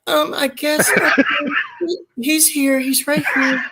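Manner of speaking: high put-on clueless wife voice; mock the confused wife on the phone; imitating the wife. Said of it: "She's like".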